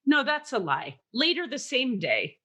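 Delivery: ordinary delivery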